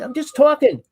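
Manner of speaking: high-pitched retold protest; mock self-defence, voice jumping up. Man defends his volume to his partner, retold protest.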